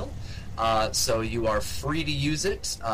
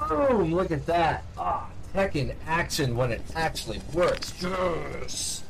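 triumphant burst of joy; excited; 6 semitones higher than usual. Real, not acted. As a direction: cheer as it finally works. Tech finally works.

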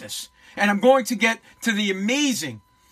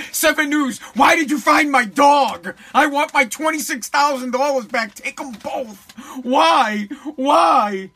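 hammed-up exasperated wail, pitched up; mock outrage; 3 semitones higher, 4 dB louder, slower than usual. Host as owner berating Seven News, mock outraged shouting. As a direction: cry out like a cheated owner, in jest. Plays owner angry at dog's return.

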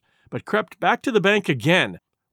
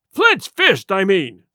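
exasperated cry; 4 dB louder, slower than usual. A narrator's reading. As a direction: cry out impatiently at the men. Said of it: "he cried".

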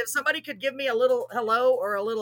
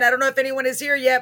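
ordinary talk

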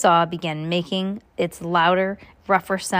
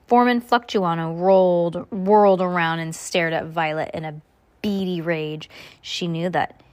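usual speaking voice

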